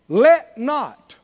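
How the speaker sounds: Jesus' words rung out from the pulpit; emphatic command. Acted. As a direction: boom LET like a command from Jesus.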